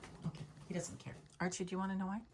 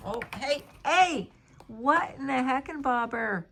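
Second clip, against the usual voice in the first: startled, loud high outburst; 5 semitones higher, 8 dB louder than usual. Real, not acted. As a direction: yelp in sudden surprise. Cat jumps on upholstered bed.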